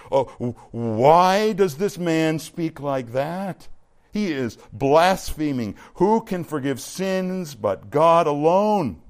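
slow, affronted scribes' challenge; indignant questioning. Acted. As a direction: question it in slow disbelief, stressing key words. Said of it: "questioning in their hearts".

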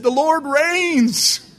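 high, loud, long-drawn comic proclamation; triumphant cry. Retold or imitated. Driver throwing the sermon title back after the crash, hammed-up holler.

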